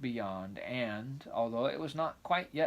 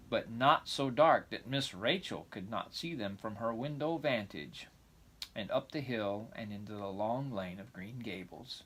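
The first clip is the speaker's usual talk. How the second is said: plain reading voice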